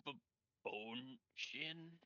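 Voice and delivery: soft, hesitant, stammered question; awkward, halting half-voice; awkward mutter. Acted. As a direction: mutter it haltingly, unsure of the name. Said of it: "Kaz awkwardly mutters".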